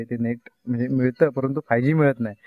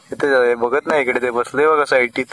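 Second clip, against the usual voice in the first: ordinary delivery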